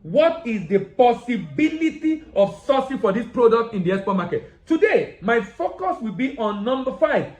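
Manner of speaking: ordinary talk, nothing marked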